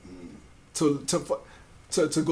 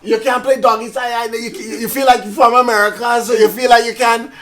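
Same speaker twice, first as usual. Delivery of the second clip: put-on loud, higher Caribbean-accented scolding; Caribbean accent on request; 6 semitones higher, 7 dB louder than usual. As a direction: imitate a cousin's Caribbean-accent telling-off. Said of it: "say it in that accent".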